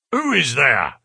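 loud, gruff challenge from within; gruff cry. A narrator's reading. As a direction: call out gruffly to whoever is there. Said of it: "cried a gruff voice from within".

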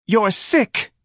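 high, loud cry of accusation; wife's high-pitched yell; angry shout. A narrator's reading. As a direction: shout it at your husband. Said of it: "Missus Levy shouted at her husband".

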